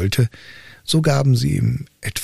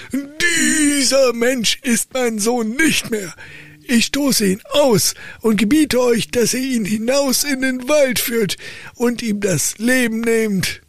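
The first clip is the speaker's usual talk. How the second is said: high, slow, grim decree; furious count's verdict; 14 semitones higher than usual. Acted. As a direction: cast the son out with cold fury. Father disowns son in fury.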